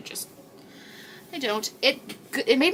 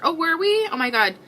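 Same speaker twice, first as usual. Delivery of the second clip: surprised; 10 semitones higher, 7 dB louder than usual. Real, not acted. Viewer says they met before.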